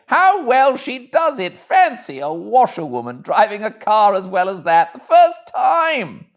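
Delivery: applauding the driver; admiring gentleman. Acted. Gentlemen voiced in story.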